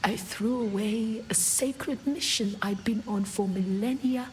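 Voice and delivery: usual speaking voice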